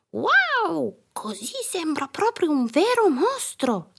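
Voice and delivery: put-on very high, thrilled child voice; amazed little girl. A narrator's reading. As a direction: marvel like a delighted kid. Girl amazed by monster costume.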